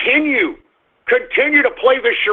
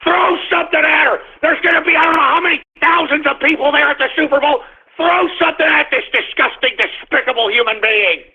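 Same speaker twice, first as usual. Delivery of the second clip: high, raised furious yell; angry yell; 8 semitones higher than usual. Real, not acted. Vlogger screaming for fans to pelt the singer, angry yell. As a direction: scream it out in outrage. Shouting for fans to throw things.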